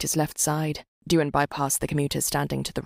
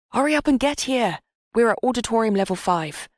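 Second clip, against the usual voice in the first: urgent friend; 7 semitones higher, 4 dB louder than usual. Acted. Friend urging him to hurry.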